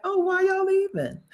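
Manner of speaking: high-pitched imitation of baffled locals; mock puzzlement. Imitating New Yorkers asking those who leave, high mock puzzlement. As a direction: ask it high up, playing baffled.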